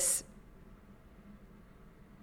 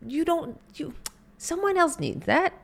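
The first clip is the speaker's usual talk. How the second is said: much higher, fluttery mock protest; self-denying refusal; 9 semitones higher than usual. Person refusing the universe's gift, much higher fluttery protest. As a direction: wave off the gift, insisting you're fine. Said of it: "Where we go".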